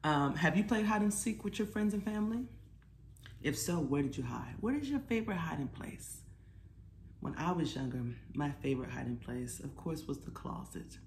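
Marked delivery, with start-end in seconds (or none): none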